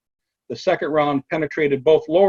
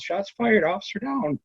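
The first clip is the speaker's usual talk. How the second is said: high-pitched mock yell, held back in volume; retold radio yell; 7 semitones higher, 4 dB quieter than usual. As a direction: imitate an urgent radio call without real shouting. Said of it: "she yells".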